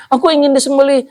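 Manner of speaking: high, begging camel voice; pleading camel. Acted. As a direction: beg for help like a desperate animal. (unta memohon dengan suara tinggi)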